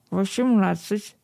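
slow mumble through a mouthful; mouth full. A narrator's reading. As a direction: gum the word with food in mouth. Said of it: "с полным ртом прошамкала она детским голосом".

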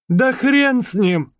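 high, perky little mouse. Acted. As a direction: play the cheeky mouse convict. (писклявый голос мыши)

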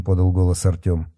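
ordinary delivery

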